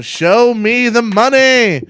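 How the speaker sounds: loud, slow shouted movie quote; Jerry Maguire shout. Retold or imitated. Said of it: "I'm gonna go".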